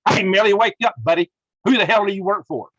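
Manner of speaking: real angry outburst, bark it out; furious shout. Real, not acted. Host yelling at Milley in outrage, furious shout. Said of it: "Ah, I'm mad".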